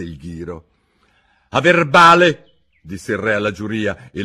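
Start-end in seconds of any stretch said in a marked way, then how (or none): 1.5-2.6 loud, higher commanding king; a king pushing his jury; stern king. Acted. King urging the jury, booming.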